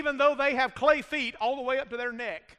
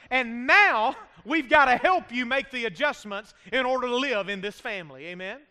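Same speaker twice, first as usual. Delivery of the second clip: ordinary talk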